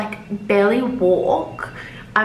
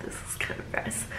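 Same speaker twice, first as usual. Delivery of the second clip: soft whispered embarrassed aside; hushed confiding aside; 15 dB quieter than usual. Real, not acted. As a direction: whisper it to the viewer.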